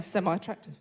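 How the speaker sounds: very soft, low echo to oneself; hushed, low disbelieving repeat; stunned muttering. Acted. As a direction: mutter it under your breath walking off. Walking away repeating the insult to herself.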